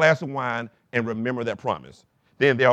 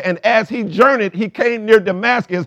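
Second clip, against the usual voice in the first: scripture read out loud and high; preached reading; 10 semitones higher, 10 dB louder than usual. Shown as a demonstration. Pastor rereading the Damascus verse to the church, preaching loudly. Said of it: "It said".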